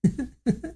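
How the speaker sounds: short high laugh; real giggle at the line. Real, not acted. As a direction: burst into giggles. Laughing after rereading tagline.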